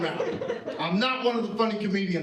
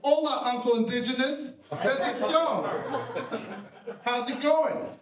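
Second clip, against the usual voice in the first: higher-pitched, hearty hello; hammed-up family greeting, pitched up; mock cheery greeting; 5 semitones higher than usual. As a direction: greet an uncle with exaggerated cheer. Mock greeting to his uncle.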